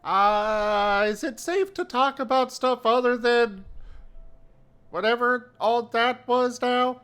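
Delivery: high, hesitant voice; nervous little character asking. Acted. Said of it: "speak up, like".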